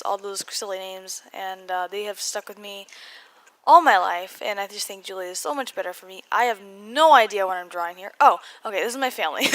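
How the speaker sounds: ordinary talk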